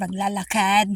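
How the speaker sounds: ordinary delivery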